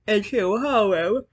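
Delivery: words pushed out in a yawn. Real, not acted. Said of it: "I know why I keep fucking yawning".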